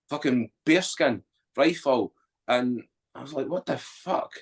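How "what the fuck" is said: much higher, softer, almost breathless; a hushed, awed swear; stunned disbelief. Retold or imitated. Disbelief at ceremonial duties.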